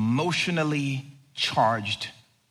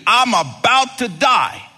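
loud emphatic preaching; 9 semitones higher, 8 dB louder than usual. Acted. Preaching at full force.

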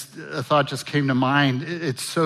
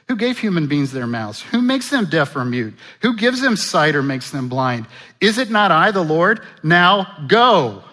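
frustrated God; 4 semitones higher, 7 dB louder than usual. Retold or imitated. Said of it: "sense a little frustration with God".

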